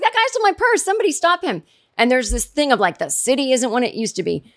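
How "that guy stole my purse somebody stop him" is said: high-pitched retold plea to stop the thief; mock call for help. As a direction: pitch it way up, half-hearted alarm. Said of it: "She's like".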